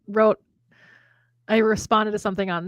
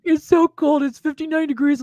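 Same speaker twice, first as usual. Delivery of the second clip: high, loud mock whining about the cold; exaggerated put-on grumbling, pitched up; mock complaint; 6 semitones higher, 4 dB louder than usual. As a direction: imitate someone complaining they're freezing. Mocking people in warm climates.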